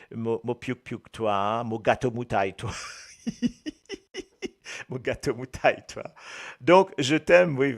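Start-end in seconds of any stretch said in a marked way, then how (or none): 2.8-4.8 a high airy burst of laughter; giggling at a silly phrase; high breathy giggle. Real, not acted. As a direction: break into a squeaky unvoiced giggle.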